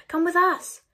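urgent plea. A narrator's reading. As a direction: call to him to come along. Children pleading with father.

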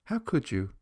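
lightly voiced unbothered boy; cool. A narrator's reading. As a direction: dismiss the grown-up without taking offence. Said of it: "said Jimbo coolly".

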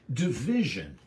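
DIVISION stressed on its middle syllable; emphatic. Shown as a demonstration. Preacher stressing the key Greek word to listeners, emphatic DIVISION. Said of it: "it's emphatic".

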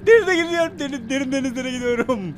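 high-pitched wail, starting loud and easing off; high frightened lament; panicked. Real, not acted. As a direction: wail in dread, descending as breath runs out. Dreading deep water.

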